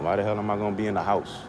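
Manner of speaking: usual speaking voice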